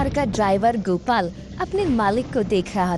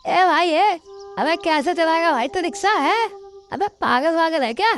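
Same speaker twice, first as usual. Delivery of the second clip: taunting heckler; 8 semitones higher than usual. Acted. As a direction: heckle him like a street lout.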